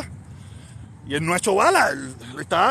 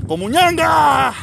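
high, slow, drawn-out taunt; a critic's insult, mocked back in a high, slow voice; mocking; 9 semitones higher, 6 dB louder, much slower than usual. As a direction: stretch out the insult high to mock him.

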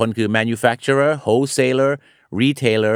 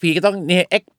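ordinary talk, nothing marked

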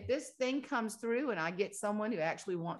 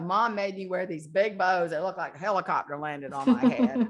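laughing complaint; 8 dB louder than usual. Retold or imitated. Friend's daughter quote, laughing complaint.

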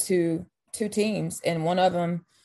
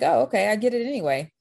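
higher, a bit louder, amused surprise; mock surprise; 6 semitones higher, 3 dB louder than usual. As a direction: act lightly caught off guard by a gift. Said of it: "So I was like".

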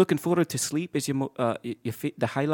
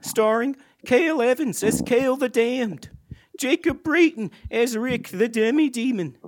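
higher, slower credits read; announcer outro; 9 semitones higher than usual. Acted. The host closes the podcast with the credits over music.

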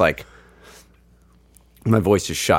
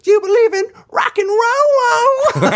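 high, slow shouted crowd call; 3 dB louder, slower than usual. Retold or imitated. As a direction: stretch it out to the crowd, laughing. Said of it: "He goes".

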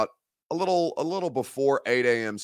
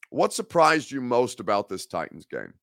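normal talking voice

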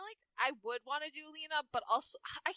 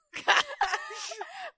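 loud, high burst of laughter; cracking up laughing; 12 semitones higher, 4 dB louder than usual. Real, not acted. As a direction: burst out laughing at your own acting. Cracks up after acting the line.

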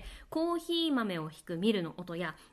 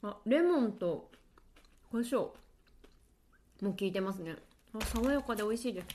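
talking while eating chips; crunching chips. Real, not acted. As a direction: speak through a mouthful of chips. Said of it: "ポテトチップスを食べながら喋ってもいいですか".

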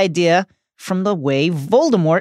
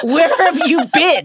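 much higher, laughing mock voice; laughing jab; 10 semitones higher than usual. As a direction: tease through a laugh. Mocking Madam Hooch late arrival.

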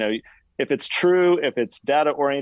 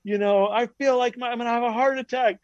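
mock panicked screaming; 10 semitones higher than usual. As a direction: plead like you're strapped to the chair. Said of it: "screaming bloody murder".

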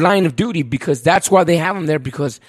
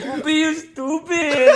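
higher, slow, laughing impression of a friend; imitating Papi; 8 semitones higher, much slower than usual. Mimicking Papi for friends, higher, slow and laughing. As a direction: drag it out, laughing through a friend's voice. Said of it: "His accent, bro".

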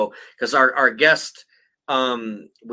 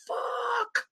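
a much higher, strangled little "Fuck."; mock anguished squeak; 8 dB quieter than usual. Dad squeaks at son ripping the collectible box, strangled and high. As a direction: squeak it out like your heart just broke. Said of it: "I'm like".

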